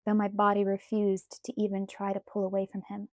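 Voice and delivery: plain reading voice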